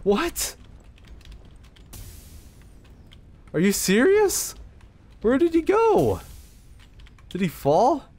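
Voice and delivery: high, shocked disbelief. Real, not acted. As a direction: squeak out the disbelief. Shock at the vanished victim.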